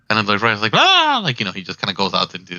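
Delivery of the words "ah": very high, loud yip; a happy little dog bark of a cry; excited dog yelp. Acted. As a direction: corgi yelping as it runs out. GM voices dog's excited yelp.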